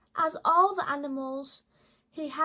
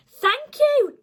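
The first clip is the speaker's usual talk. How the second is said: high, louder little fox voice; grateful fox; 11 semitones higher, 9 dB louder than usual. Acted. The fox thanks George for the sock, high and grateful. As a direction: squeak out a happy thanks.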